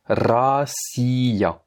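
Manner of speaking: "Россия" with a trilled R, drawn out; rolled R word. Shown as a demonstration. Teacher modelling a Russian word with rolled R. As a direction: model it slowly. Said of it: "Voici quelques mots qui contiennent".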